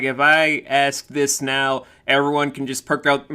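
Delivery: normal talking voice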